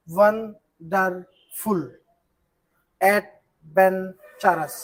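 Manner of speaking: very slow, each syllable set apart. Shown as a demonstration. Teacher giving examples of many-syllable words, syllable by syllable. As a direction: chop "wonderful" and "adventurous" into slow separate syllables. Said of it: "এক প্রয়াসে উচ্চারণ করা না যায়".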